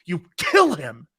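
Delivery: ordinary talk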